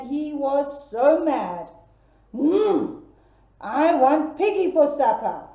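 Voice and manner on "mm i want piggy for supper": hungry wolf. Acted.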